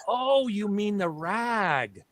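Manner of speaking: high, drawn-out open "a" in "rag"; mock them with a long "raaag"; mimicked "rag". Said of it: "And they said".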